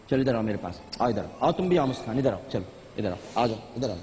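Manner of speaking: gentle coaxing. Real, not acted. Host coaxing crying children.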